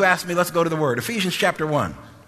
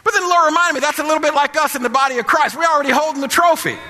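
loud, high shouted preaching; shouting preacher driving the point home; full-force preaching; 11 semitones higher, 5 dB louder than usual. Acted. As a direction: preach it hard to the congregation.

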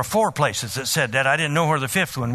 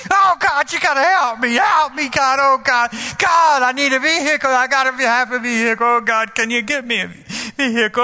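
frantic prayer for a car, voice up; lost it; 6 semitones higher than usual. Retold or imitated. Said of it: "I lost it again".

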